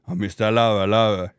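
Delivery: deep Jamaican [name] voice; low rumbling [name] growl; [name] impression. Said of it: "the go-to for [name] impressions".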